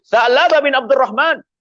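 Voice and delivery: high, drawn-out call. Acted. Umar hails the boy he has tracked down, calling out. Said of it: "Sayyidina Umar menyuruh".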